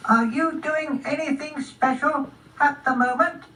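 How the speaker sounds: high-pitched little snail voice; small high snail question; inquiring snail. Acted. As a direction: ask as a tiny, polite snail.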